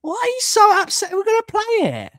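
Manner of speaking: very high-pitched mimicked whine; mock upset questioning. Streamer mimicking commenters asking why so upset, mock upset. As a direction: push it right up high, mimicking. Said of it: "They always sound like".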